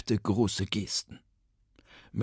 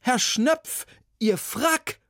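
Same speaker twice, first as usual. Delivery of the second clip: startled; 14 semitones higher, 3 dB louder, much slower than usual. Acted. Assistant alarmed at the coat.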